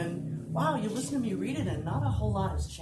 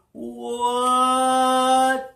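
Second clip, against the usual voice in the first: higher, booming one-word cry; loud held shout; 4 semitones higher, 11 dB louder than usual. Acted. Narrator writes on his favorite wall, shouting the word. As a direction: belt it out and hold it.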